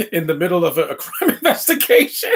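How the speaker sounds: breaking up mid-sentence, pitch leaping; helpless laughter pushing the voice high; laughing. Real, not acted. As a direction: crack up until the words squeak. Cracking up at the absurd scene.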